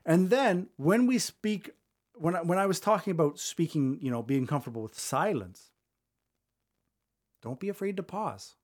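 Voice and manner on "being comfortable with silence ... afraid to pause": long silence après SILENCE, avant DON'T; pause assumée. Shown as a demonstration. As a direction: let SILENCE hang, then softly say DON'T BE AFRAID TO PAUSE. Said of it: "just like I did there".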